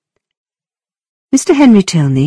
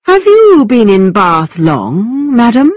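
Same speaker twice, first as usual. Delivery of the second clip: slow, higher, over-courteous gentleman; exaggerated polite voice; 6 semitones higher, 4 dB louder, slower than usual. A narrator's reading. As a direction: ham up the politeness, slowly. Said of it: "in an exaggerated, extremely polite voice".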